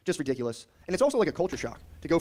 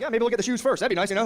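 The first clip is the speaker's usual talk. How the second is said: hopeful; 7 semitones higher, 5 dB louder than usual. Retold or imitated. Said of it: "I'm like".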